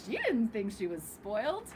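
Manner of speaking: plain reading voice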